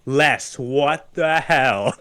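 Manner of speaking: very slow, drawn-out disbelief; imitated dumbfounded father; stunned dad. Retold dad finds the doll.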